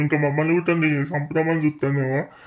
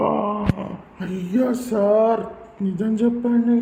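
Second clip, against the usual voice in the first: much higher, slow shocked plea; 5 semitones higher, slower than usual. Acted. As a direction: beg the doctor in disbelief. Friend shocked by the death.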